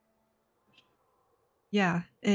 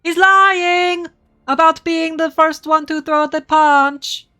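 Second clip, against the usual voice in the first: loud, high indignant outburst; loud accusation; 7 semitones higher, 9 dB louder, slower than usual. Acted. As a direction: shout him down as a liar. Player shouts accusation in character.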